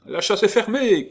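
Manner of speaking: high, loud call; shouting. Acted. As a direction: shout it across the room. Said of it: "cria Gondrequin".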